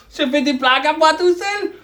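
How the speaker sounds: ordinary talk, nothing marked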